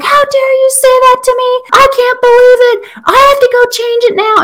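high, shrill mock teen outburst, way up in pitch; squeaky hammed-up teenage meltdown; outraged teen daughter. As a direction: play the furious middle-school girl who snaps at mom. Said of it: "And she just freaks out".

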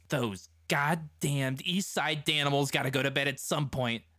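vengeful grumble. A narrator's reading. Billy tells his crew about the enemy, vengeful. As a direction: seethe it at your crew. Gang boss vows revenge.

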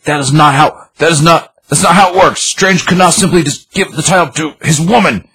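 high-pitched indignant outburst, acted out; losing it. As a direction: mimic a man losing his cool, pitch way up. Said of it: "he just starts losing his, losing it".

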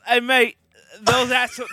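high, loud shouted Kiwi try; attempted New Zealand accent. New Zealander shouting at the French, high loud attempted Kiwi accent. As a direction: bellow it like a riled-up New Zealander. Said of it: "No, that's not it either".

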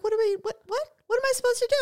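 high, panicked mock daughter. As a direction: squeak it out like a lost kid. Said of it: "she'll be like".

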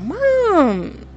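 a lightly voiced puzzled son, high; son's voice. Son calling out to his excited mother, lightly voiced. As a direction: ask Mom like a confused teen. Son reacting to screaming mom.

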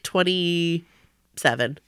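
ordinary talk, nothing marked

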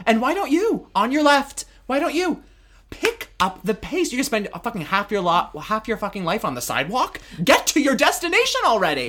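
high-pitched, impatient rant at dawdlers; hammed-up nagging at people in your way; mock exasperated scolding. Acting out scolding slow walkers.

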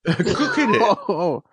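slow words through real laughter; laughing. Real, not acted. Laughs while explaining the dinner.

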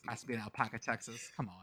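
muttered disbelief. Real, not acted. Disbelief at the place name.

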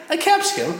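high, disbelieving question; can't believe the word exists; incredulous. Acted.

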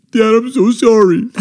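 high, loud pleading; hammed-up frantic sorry; panicked apology. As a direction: imitate a scared teen begging dad. Retold terrified apology to dad.

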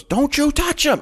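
very high play-acted shout; mock protective warning. Cop defending the informant before shooting, mock fierce warning. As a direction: warn them off, hammed up and high.

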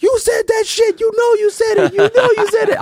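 very high, yelled re-enacted heckle; heckling. Storyteller heckles the rapper from the crowd, high yelling. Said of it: "I'm standing in the crowd heckling him".

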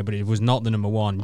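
ordinary talk, nothing marked

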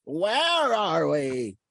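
very slow, drawn-out questions; mock bewilderment. Time travelers after the jump, hammed-up slow bewilderment. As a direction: stretch each word in hammed-up confusion.

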